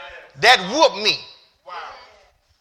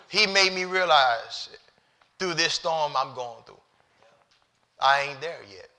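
ordinary talk, nothing marked

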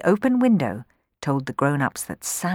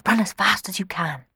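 whispered urging; 3 semitones higher than usual. Acted. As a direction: whisper it out of the window. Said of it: "murmured Rowan".

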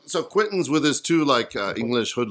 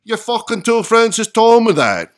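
loud, high attempt at a Scottish accent; 9 semitones higher, 10 dB louder than usual. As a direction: belt out the punchline in a put-on Scottish accent. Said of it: "let me do my Scottish accent".

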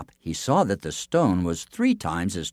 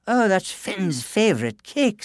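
higher, slow sweet wife's voice; innocent Una; 12 semitones higher than usual. A narrator's reading. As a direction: say it all innocence. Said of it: "said Una".

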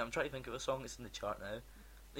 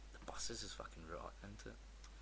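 whispered, much softer; 6 semitones lower, 14 dB quieter than usual. Real, not acted. Telling his mate about rotten buses, in a whisper. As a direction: hush it like a secret.

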